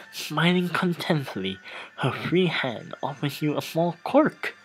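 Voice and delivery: reading the scene aloud, a touch theatrical; game text narration. Acted. Streamer reading game text aloud to viewers, lightly narrated. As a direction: narrate the erotic scene like a storyteller.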